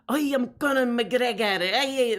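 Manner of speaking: a thin stab at an Irish brogue; Conor McGregor Irish. Acted. Chat asks for McGregor, a weak Irish accent attempt. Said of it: "Conor McGregor impression".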